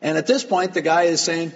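normal talking voice

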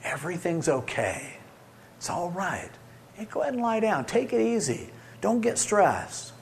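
soft, gentle reassurance; a shepherd calming his sheep, softly reassuring; soothing shepherd. Acted. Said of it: "a shepherd who tells the sheep".

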